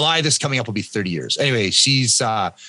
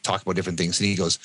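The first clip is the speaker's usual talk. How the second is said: ordinary talk, nothing marked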